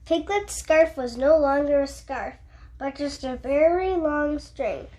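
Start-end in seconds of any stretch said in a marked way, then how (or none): none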